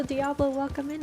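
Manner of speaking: ordinary talk, nothing marked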